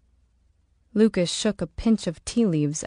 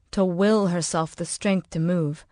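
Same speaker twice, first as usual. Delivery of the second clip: ordinary delivery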